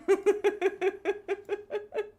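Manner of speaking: high giggling in quick bursts; real long laugh at oneself; helpless giggle. Real, not acted. Streamer laughing at own excuse for spending, giggling.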